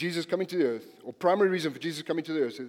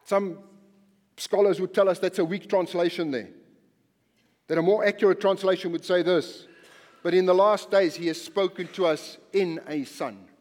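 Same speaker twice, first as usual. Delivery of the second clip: loud, driving sermon voice; rising fervent preaching, punching each phrase; preaching at full force; 3 semitones higher, 5 dB louder than usual. Acted. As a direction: preach it hard to the congregation.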